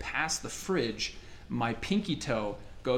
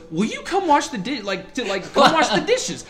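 much higher, louder mom voice; imitating mom calling for help with dishes; mom's voice; 9 semitones higher, 7 dB louder than usual. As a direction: mimic mom calling from the kitchen. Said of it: "my mom goes".

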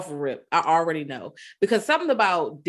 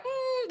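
high doubtful mm; a disbelieving hum; skeptical hum; 6 dB quieter than usual. Retold or imitated. Skeptical dad grunts.

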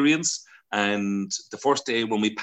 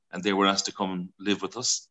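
ordinary talk, nothing marked

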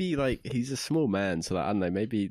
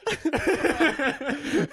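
bursts out in high laughter; a real laugh at the intro; 4 dB louder than usual. Real, not acted. Host cracks up introducing his friend, laughing.

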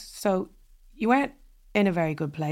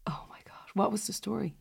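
breathless hushed whisper of amazement; a gasped, awed whisper; 8 dB quieter than usual. Real, not acted. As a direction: whisper it, stunned. Listener awed by the confession.

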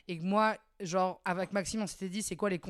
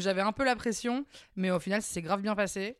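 normal talking voice

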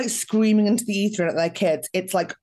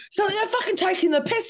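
mock angry dad; 12 semitones higher than usual. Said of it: "a British dad every corner you turn going".

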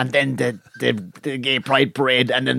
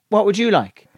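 higher, put-on barman's question; attentive barman; 7 semitones higher than usual. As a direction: ask like a barman taking the order. Voicing an attentive Irish barman.

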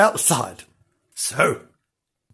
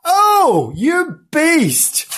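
high, loud cry of outrage; indignant outburst; 11 semitones higher, 5 dB louder, much slower than usual. Acted. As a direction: burst out in storybook indignation. Cat finds the plate empty.

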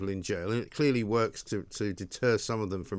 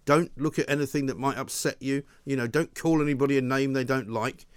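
normal talking voice

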